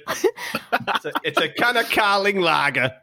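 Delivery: put-on mystery accent with a drawn-out Carling lager; unplaceable put-on accent. Presenting his can of lager, in a put-on accent. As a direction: put on an odd accent you can't name. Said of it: "I don't know what this accent is".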